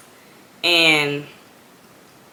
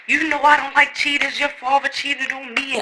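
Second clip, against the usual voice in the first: preaching mother; 6 semitones higher than usual. Retold or imitated. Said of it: "she just preaching about".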